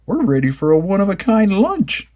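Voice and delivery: high, goofy Shaggy voice. A narrator's reading. Shaggy announcing the picnic lunch, high and goofy. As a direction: play Shaggy, loose and goofy. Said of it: "Shaggy said".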